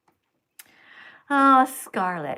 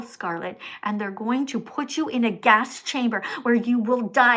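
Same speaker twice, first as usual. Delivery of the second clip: normal talking voice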